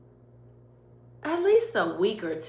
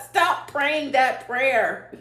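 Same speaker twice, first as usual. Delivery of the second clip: slow and firm, higher and louder; emphatic God quote; 7 semitones higher, 8 dB louder, slower than usual. Retold or imitated. God telling her to stop the prayer, slow and emphatic. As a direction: hammer out each word as a mock command. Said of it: "I know God don't talk like this".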